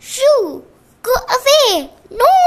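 high loud panicked shouts; scared shouting. Acted. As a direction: shoo away the bee, panicking. Girls scared of a bee.

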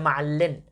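ma'allin stressed on its final "in"; stress on last vowel. Shown as a demonstration. Teacher giving the full phrase, ma'allin stressed at the end. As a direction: land firmly on the end of ma'allin. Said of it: "But in this case, we'll say".

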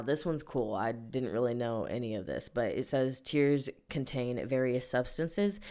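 normal talking voice

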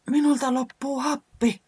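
high, puffing and winded; out of breath. Acted. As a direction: gasp it out after blowing. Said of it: "säämies totesi". (hengästyneenä)

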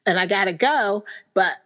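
normal talking voice

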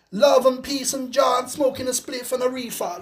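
mock his put-on Jamaican lilt; mock Jamaican accent. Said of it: "me mocking the voice he puts on".

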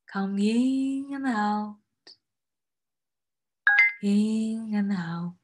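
slow breathing cue. Acted.

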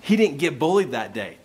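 usual speaking voice

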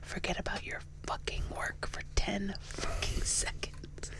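soothing whisper full of swearing; profane meditation whisper. Acted. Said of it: "those people who do those meditations".

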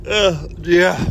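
stretched out through a yawn. Real, not acted. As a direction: say it mid-yawn. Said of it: "I'm yawning".

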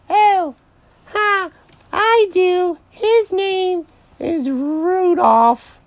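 very high, drawn-out bunny voice; hammed-up high cartoon rabbit; coy Mary the rabbit. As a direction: announce a mate in a stretched squeal. Mary's put-on rabbit voice.

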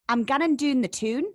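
broad Geordie with "doon" and "tune" vowels; Geordie accent. Shown as a demonstration. Geordie host challenging the American guest, broad Geordie accent. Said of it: "sort of a Geordie".

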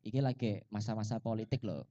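very soft, low warning; low and hushed, as a discreet caution. Real, not acted. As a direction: drop right down to a careful murmur. Cautions partner about political lyric.

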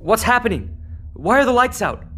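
startled alarm. Acted. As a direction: caught off guard as the lights die. Lights suddenly go out.

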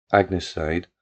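usual speaking voice

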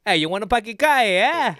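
loud mock Italian New Yorker; Italian New York accent. Host showing what it sounds like, Italian New York accent. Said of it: "like an Italian in New York".

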